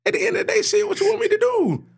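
high, excited hype-man call; hyped-up boast. Acted. Announcing to cohost [name]'s return, high excited hype. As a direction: crow it out like a triumphant comeback. Hyping his return to the pod.

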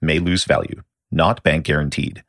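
ordinary delivery

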